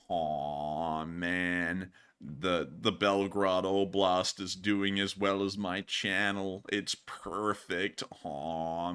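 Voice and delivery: low, soft, slow drawl; Hot Dog Man. Pretend. Insisting to viewers all is perfect, low skit voice. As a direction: drag it out low and quiet in denial. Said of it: "Hot Dog Man is excellent at gaslighting people".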